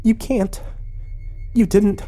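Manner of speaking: high-pitched shocked disbelief; aghast. A narrator's reading. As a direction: gasp it out high, horrified. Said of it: "eyes widened and her face paled".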